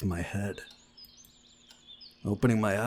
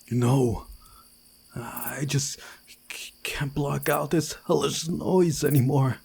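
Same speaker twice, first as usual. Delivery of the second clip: panicked pleading; 6 semitones higher, 5 dB louder than usual. Acted. Nightmare, can't block the noise.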